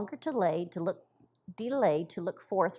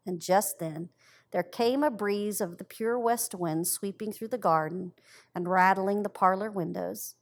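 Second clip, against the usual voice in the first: plain reading voice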